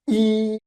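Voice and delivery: "E" held long and high; a sustained high level "E"; first tone. Shown as a demonstration. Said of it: "first tone".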